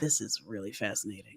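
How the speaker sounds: low, quiet, dry amazement; muttered flat fascination; deadpan. Retold or imitated. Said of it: "I'm like".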